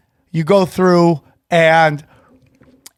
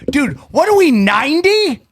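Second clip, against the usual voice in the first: high-pitched retold disbelief; mock incredulous; 8 semitones higher than usual. Friend retelling his objection to sandcastles, incredulous. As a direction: protest it, pitch jumping up. Retold scornful reply to roommate.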